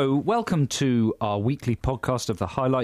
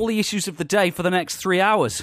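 high, punchy talk-radio intro; mock phone-in host; 10 semitones higher, 5 dB louder than usual. Host opening a spoof political phone-in, mock presenter voice. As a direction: sell it like a hard-hitting political phone-in presenter.

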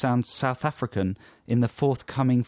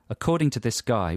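ordinary talk

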